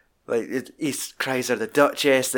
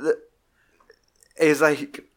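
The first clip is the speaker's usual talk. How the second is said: usual speaking voice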